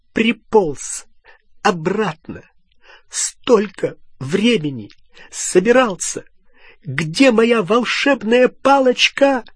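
high, slow, indignant outburst; angry, high-pitched demand building at the end; furious tirade. A narrator's reading. Furious Hermione attacks returning Ron.

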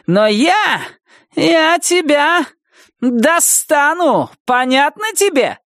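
high, hammering, slowed threats; raging threats. Acted. Wilma threatening Nettie on the phone, raging. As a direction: pound every threat home.

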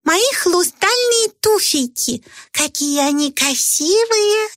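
put-on high, slow, delighted girl; proud little princess. A narrator's reading. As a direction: show off your slippers proudly. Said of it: "Горделиво она проговорила".

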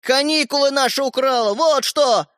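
high accusing cry; calling out angrily. A narrator's reading. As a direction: hurl the accusation down the hall. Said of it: "прокричал ей Родька из коридора". (прокричать обвинение)

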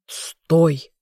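restrained growl of warning; growled command. A narrator's reading. Man grabbing her arm at the water, growled command. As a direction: growl it like an order to halt. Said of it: "рыкнул он".

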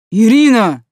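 sudden loud warning shout. A narrator's reading. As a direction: yell her name in alarm. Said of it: "неожиданно заорал Мик".

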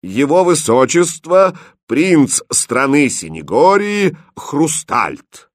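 high, ringing herald's call; loud announcement. Acted. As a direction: proclaim it loudly, stretching the titles. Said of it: "громко объявив". (громко объявляя)

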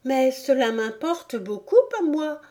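put-on high, insistent girl; indignant little-girl protest. A narrator's reading. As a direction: object earnestly as a child. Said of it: "protesta Alice".

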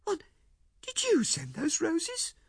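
high, slow woman's voice in dismay; wife's dismayed question. A narrator's reading. Wife realizing her husband sent the roses, slow high dismay. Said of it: "she cried".

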